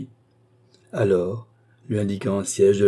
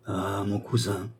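supplication douce; 7 dB quieter, slower than usual. A narrator's reading. Louis supplie le marquis.

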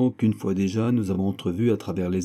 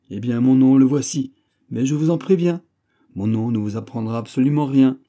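solennel et animé; 5 semitones higher, 6 dB louder than usual. A narrator's reading. D'Artagnan livre enfin son nom.

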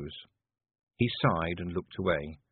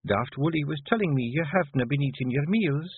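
higher Scots wife, treading carefully; 7 semitones higher than usual. A narrator's reading. Wife probing her husband's lost appetite, carefully. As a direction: gently broach a worry in a Scottish accent. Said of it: "said Jeanie carefully".

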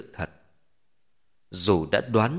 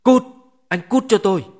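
loud, high furious shout; enraged, voice thrown up high and hard; agitated outburst; 10 semitones higher, 8 dB louder than usual. A narrator's reading. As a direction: yell at them to get out. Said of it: "cảm xúc kích động nói".

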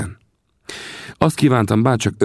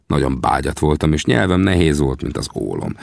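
plain reading voice

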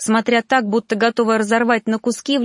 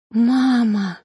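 lightly sobbed call to a mother; tearful call; 3 semitones higher than usual. A narrator's reading. Dead girl's soul calls her mother, gently sobbing. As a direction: call out with a touch of tears. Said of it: "всхлипывала душа погибшей".

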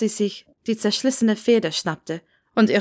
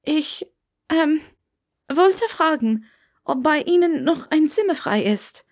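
higher, hesitant Hermione asking; a little nervous, raised young voice; young Hermione voice, unsure; 8 semitones higher than usual. A narrator's reading. Hermione asks the old Scot for a room, higher and hesitant.

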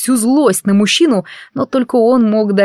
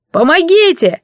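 high, loud cry for help; yelling for help; 10 semitones higher, 4 dB louder than usual. A narrator's reading. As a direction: scream it from the next room. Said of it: "заорал рыжий из кухни". (крик о помощи)

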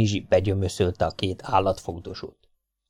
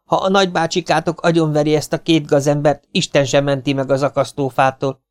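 scolding woman; 6 semitones higher than usual. Acted. Hollóné warns the boys.

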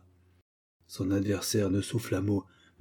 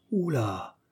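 ordinary delivery

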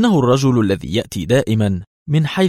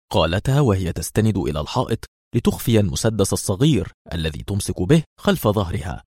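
ordinary delivery, nothing marked

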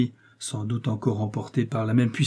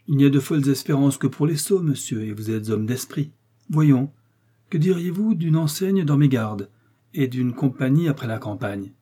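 raised, louder voice of a commanding cardinal; authoritative cardinal voice, pitched up; 4 semitones higher, 5 dB louder than usual. Acted. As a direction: speak down to the young man as the cardinal. Cardinal continues speaking.

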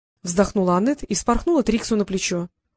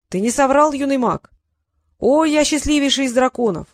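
higher and louder, overjoyed; joyful dragon; 5 semitones higher, 4 dB louder than usual. A narrator's reading. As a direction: burst out with dragon's delight. Dragon rejoices at Trix. (дракон радуется Триксу, громко и высоко)

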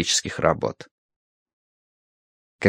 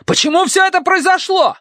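extremely high, angry cry; shrieked, enraged question; furious shout; 3 dB louder than usual. A narrator's reading. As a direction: demand an answer at the top of the register. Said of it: "крикнул лучник, маленький разъярённый человек".

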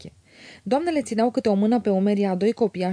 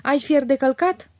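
higher, slow-paced call; calling from afar; 5 semitones higher than usual. A narrator's reading. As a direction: draw out a question to someone far away. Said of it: "țipă ea de departe".

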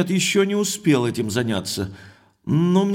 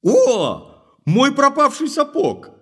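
higher cry of triumph at finding the lost boot; triumphant exclamation; 7 semitones higher, 4 dB louder, slower than usual. Acted. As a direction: exclaim it in triumph, pitch jumping up. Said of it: "издал вдруг торжествующее восклицание".

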